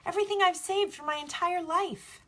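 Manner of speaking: put-on distressed boy. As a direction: cry out the loss in a high young voice.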